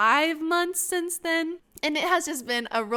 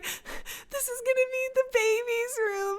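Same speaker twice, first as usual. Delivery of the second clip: slow, high squeaky fake sob; mock sobbing; 11 semitones higher, 4 dB quieter than usual. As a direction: draw it out in an imitated tearful squeal. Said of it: "sobbing uncontrollably".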